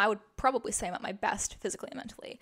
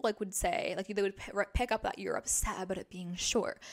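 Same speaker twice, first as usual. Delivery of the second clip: ordinary delivery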